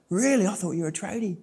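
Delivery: high, startled "Really?" then a dropped-off afterthought; surprised stranger. Retold or imitated. Guy hearing brother works at uni, high surprised disbelief. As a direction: play the stunned guy who guessed wrong.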